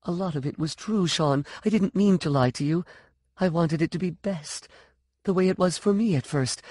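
put-on anxious, childlike confession; young, frightened. A narrator's reading. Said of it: "Her voice sounded young, frightened".

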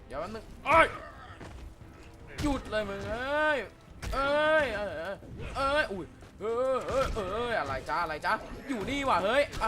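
high, loud frantic yelling; panicked shout. Real, not acted. Gamer yelling at his opponent to stop, high and loud.

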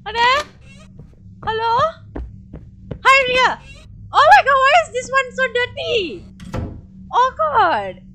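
high, loud call. Real, not acted. Streamer calling out while exploring, high and loud. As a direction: call out searching the house.

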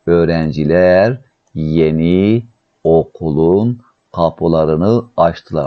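very slow, stretched word endings; word by word, with pauses between; slow dictation. Shown as a demonstration. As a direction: dictate slowly to a class. Teacher dictates the translation.

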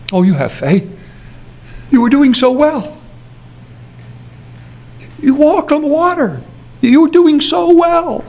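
gentle, rising encouragement to Peter; tender Jesus. Acted. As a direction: speak it tenderly, not scolding. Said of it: "I think this is a tender moment".

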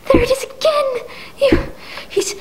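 high, breathy whispered cry; terrified whisper. Acted. Invalid realizes the killer is near, whispered cry. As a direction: whisper in pure terror.

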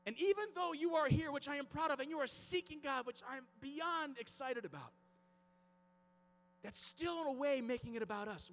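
high, insistent preacher's voice; impassioned preaching. Real, not acted. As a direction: preach it hard, driving home each phrase. Preaching with urgency.

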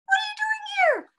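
very high squeaky toy monkey. A narrator's reading. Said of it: "she said in a squeaky voice".

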